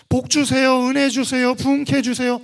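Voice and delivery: loud, high mock petition; imitated clamouring prayer; insistent begging.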